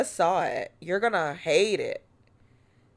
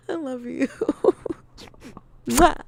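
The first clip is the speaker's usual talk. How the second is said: normal talking voice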